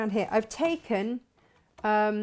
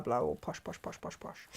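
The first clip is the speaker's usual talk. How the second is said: mock posh; 9 semitones lower, 9 dB quieter than usual. Mocking fancy expensive clips.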